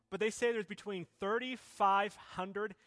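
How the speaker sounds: loud, high, building sermon delivery; full-force preaching. Acted. Pastor preaching to the church, loud and rising. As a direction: preach it out over the music to the congregation.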